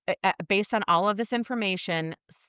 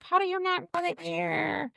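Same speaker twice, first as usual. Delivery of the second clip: high, mocking incredulous tone; lightly mocking squeal; mocking everybody; 10 semitones higher than usual. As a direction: mimic the crowd's disbelief. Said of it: "Everybody was like".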